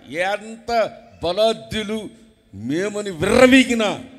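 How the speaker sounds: loud, rising sermon shout; full-force preaching. Acted. Preacher shouting to the congregation, fiery and loud.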